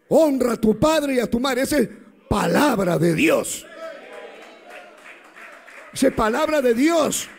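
shouted scripture, high and loud; thundering proclamation. Real, not acted. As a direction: preach it at full force, every word hammered. Preacher proclaims the commandment.

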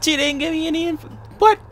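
high-pitched indignant whine; high outraged squeal. Real, not acted. Outraged at withheld gossip.

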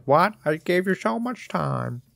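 high, put-on disbelief; imitated indignant complaint. Mission giver hearing it's not finished, mock indignant. Voices boss's imagined complaint.

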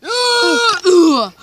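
high, loud drawn-out yell; loud acted scream into a grunt; mock fight scream. Fighter cries out in a play fight, high scream.

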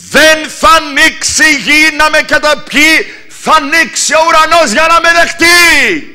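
high, loud, slow declaration; proclaiming together. Shown as a demonstration. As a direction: proclaim it for all to repeat. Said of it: "όλοι μαζί". (ο ιεροκήρυκας διακηρύσσει δυνατά και αργά μαζί με όλους)

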